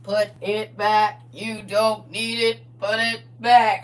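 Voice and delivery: slow robot voice warning; drawn-out robotic command. Pretend. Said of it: "in my robot voice".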